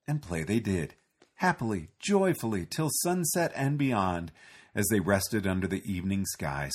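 usual speaking voice

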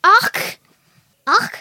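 loud, high Scottish exclamation; Scottish accent. Shown as a demonstration. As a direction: throw out the word in a Scottish accent. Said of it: "people talking in a Scottish accent".

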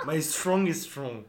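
slow mock-English accent; dragged-out French-accented English; imitated English accent. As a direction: mimic a friend's clumsy English accent. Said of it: "et avec cet accent".

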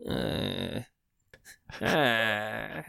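strained feline croak then laugh; sick cat noise. Acted. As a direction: hack like a cat with a hairball. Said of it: "are you gonna do a cat voice".

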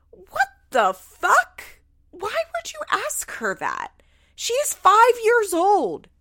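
high, loud, drawn-out incredulity; outraged disbelief. Real, not acted. Appalled at mom questioning a five-year-old, high exasperated outburst. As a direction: stretch each word in appalled protest.

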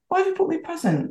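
fast, very high mock-husband question; rapid high-pitched husband voice; suspicious husband. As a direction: imitate his baffled suspicion. Husband surprised by present.

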